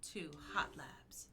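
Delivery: slow hushed whisper. Real, not acted. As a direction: breathe it like a secret. Private joke to co-host.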